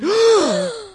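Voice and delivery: a sharp, loud shocked gasp. Acted. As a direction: gasp as if scandalised. Reacts to the letter's signer.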